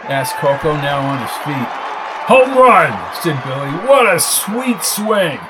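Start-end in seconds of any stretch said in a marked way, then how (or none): 2.3-2.9 shouting with joy, higher and louder; excited shout. Acted. Crowd roars, excited call. 3.9-5.4 slow, lightly voiced old man. Old player admiring.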